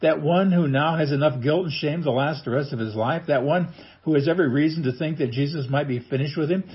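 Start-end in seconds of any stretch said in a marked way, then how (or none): none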